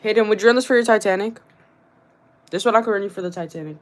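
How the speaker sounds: ordinary talk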